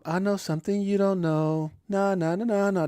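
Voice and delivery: put-on sing-song kid teasing his friends; childish taunt. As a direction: tease like a kid with a secret. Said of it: "you're like".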